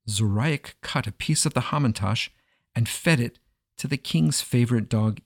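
ordinary delivery

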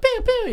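high pew pew laser sound; laser pew noise. Pretend. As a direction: make the toy gun fire. Toy laser sound effect.

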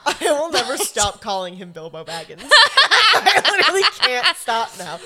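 loud, laughing all the way through. Real, not acted. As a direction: giggle out the confession. Laughing at Bilbo Baggins.

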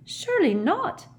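put-on very high, shocked girl's voice; worried. A narrator's reading. Hermione fearing school closure, high and worried. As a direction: protest high in worried disbelief. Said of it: "looking worried".

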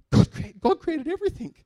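high, loud imitation of an Indian friend's accent. Preacher imitating his Indian coworker, pronounced Indian accent. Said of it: "With a very pronounced Indian accent".

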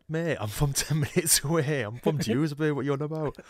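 reply in amused disbelief; incredulous retort. Retold or imitated. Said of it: "And I was like".

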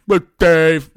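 very loud put-on blurt; mock introduction. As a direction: boom the name in mockery. Mocking wife's introduction.

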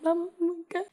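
high-pitched whiny mock sob; mock crying. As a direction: imitate a kid wailing to mom. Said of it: "I used to come home crying like".

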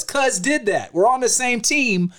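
high-pitched, insistent retort; imitated anger. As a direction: act out an irritated rapper defending a teammate. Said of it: "he was angry".

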